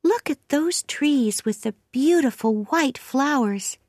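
put-on high-pitched little girl voice; chatty child, high voice; excited orphan girl. A narrator's reading. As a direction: voice a small girl admiring the view, pitched high.

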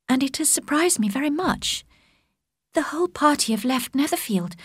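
a hint of a soft elder sister; gentle Jane. Jane reports the letter.

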